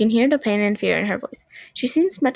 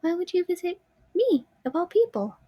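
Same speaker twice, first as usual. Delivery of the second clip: put-on little girl voice; 8 semitones higher, 4 dB quieter than usual. Marionette voiced in story.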